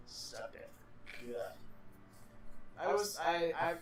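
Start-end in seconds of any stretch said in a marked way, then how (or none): 0.1-1.4 soft, low mumbled repeat; quiet echo. Pretend. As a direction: echo it quietly under your breath.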